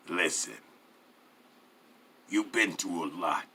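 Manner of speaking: low threat. A narrator's reading. Mafia man inches from captive's face, low menacing persuasion.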